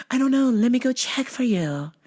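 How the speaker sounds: high, put-on helpful clerk; fake-sweet high voice stalling a caller; disguised phone voice. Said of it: "I'm gonna change my voice every time you call".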